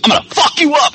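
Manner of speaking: high-pitched mock fury; hammed-up angry outburst; mock battle threat. Acting out Carol attacking someone.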